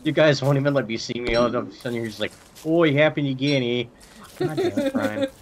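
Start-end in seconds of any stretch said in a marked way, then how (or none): 2.7-3.8 lightly Canadian, rising eh; Canadian accent. Pretend. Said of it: "my Canadian accent". 4.4-5.3 shrill fed-up complaint, very high; high exasperated voice. Pretend. Voicing annoyed teammate.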